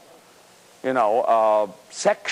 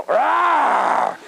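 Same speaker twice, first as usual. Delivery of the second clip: loud mock growl, high; 9 semitones higher, 5 dB louder than usual. Shown as a demonstration. Roaring at your own mirror, playful growl. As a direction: let out a big roar at the mirror. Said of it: "and growl".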